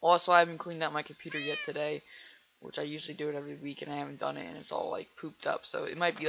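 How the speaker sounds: normal talking voice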